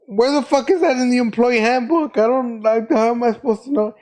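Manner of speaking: mock outrage at an unfair rule; exasperated protest. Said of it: "I was like".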